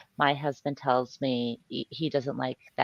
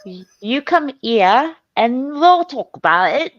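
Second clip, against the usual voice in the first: mock Cockney; 9 semitones higher, 9 dB louder than usual. Mimicking Cockney in response.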